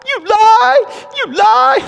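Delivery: put-on very high, loud child's cry; be a wounded five-year-old shouting back; a five-year-old boy yelling at someone he disagrees with; hurt angry little boy. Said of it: "in this little hurt, angry voice".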